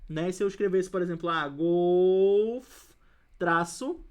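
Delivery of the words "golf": "golf" drawn out loud, stopping on the F; loud, lengthened fragment "golf"; word cut at F. Shown as a demonstration. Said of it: "inho pra cá pra baixo".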